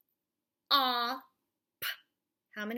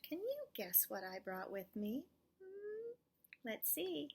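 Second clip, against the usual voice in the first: ordinary talk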